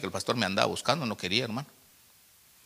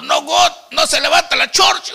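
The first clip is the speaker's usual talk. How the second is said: very high, loud mock complaint; mock tearful wail; 12 dB louder than usual. New convert telling the pastor the church won't rise, mock tearful wail. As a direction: imitate a desperate, wailing complaint. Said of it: "y se puso a llorar".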